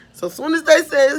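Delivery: usual speaking voice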